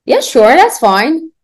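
teacher voicing Sally to the student; playing Sally. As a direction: act out Sally agreeing cheerfully. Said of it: "I'm Sally".

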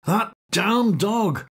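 annoyed man in bed cursing the barking dog; annoyed outburst. Acted. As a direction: snap it out, fed up with the barking. Repeat louder, annoyed.

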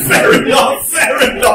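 loud and high, through laughter; laughing loud fair enough. Real, not acted. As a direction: call it out while laughing. Laughing reply to the joke.

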